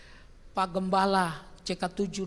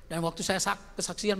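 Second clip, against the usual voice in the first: ordinary talk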